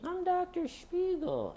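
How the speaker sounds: a falsetto squeak, nerves shot; mock high squeak. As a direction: squeak it out like a defeated man. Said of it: "And he ended like this".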